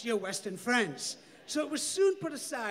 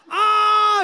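loud high shriek of panic; high scream; 10 semitones higher, 14 dB louder than usual. A narrator's reading. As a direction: scream as she's wrestled down. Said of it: "Shabana screamed".

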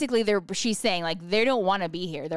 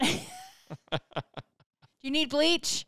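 teasing partner; 7 semitones higher than usual. Pretend. Partner at door.

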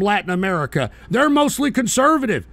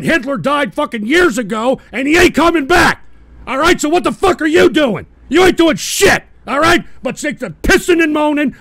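loud, higher yelling rant; angry shout; 4 semitones higher, 4 dB louder than usual. Real, not acted. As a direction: chew him out at full volume. Yelling at a named viewer.

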